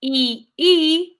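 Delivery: letters spelled out slowly; spelling slowly. Shown as a demonstration. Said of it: "Can you do that for me?".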